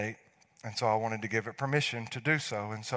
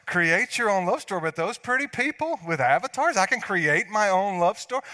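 mock delight; 9 semitones higher, 7 dB louder than usual. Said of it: "I thought".

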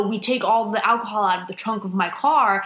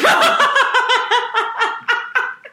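high shrieking laugh; 7 dB louder than usual. Real, not acted. Laughing at own story.

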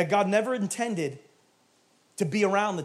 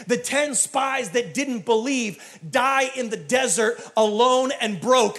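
higher, slow hammered preacher delivery; impassioned preaching; 5 semitones higher, 5 dB louder than usual. Acted. Preacher warning of the spies' fate, impassioned preaching. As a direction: build in force, stretching key words. Preaching builds to full force.

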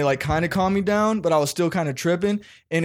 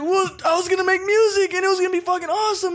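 much higher, fast, whiny; teen whining an excuse to his angry dad; faster than usual. Retold or imitated. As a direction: rush it out in a high teen whine. Excuse to dad, mocking himself.

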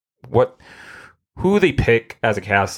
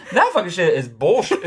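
high-pitched protest through laughter; mock outrage; 10 semitones higher than usual. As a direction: complain in a high, amused squeal. Acted outrage over getting C-File.

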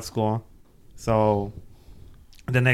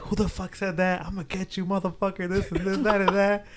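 higher, softer mock threat; lightly voiced angry girl, pitched up; imitated angry girl; 8 semitones higher, 4 dB quieter than usual. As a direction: put on a higher voice for her threat. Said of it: "the girl that he was with saying".

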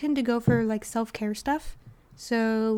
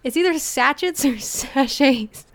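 louder, laughing through the word; 2 semitones higher, 7 dB louder, slower than usual. Real, not acted. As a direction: giggle while saying it. Laughs over pronunciation.

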